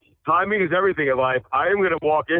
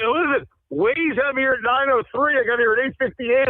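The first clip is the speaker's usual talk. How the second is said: excited; 9 semitones higher than usual. Real, not acted. Gloating he beat the GPS.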